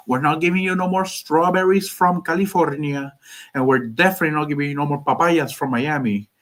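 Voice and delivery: put-on comic Cuban-accented threat. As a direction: scold like a fed-up Cuban politician. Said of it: "why I did it like that as a Cuban".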